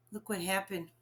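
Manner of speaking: usual speaking voice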